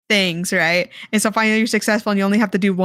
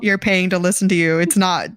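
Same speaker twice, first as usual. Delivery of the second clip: ordinary talk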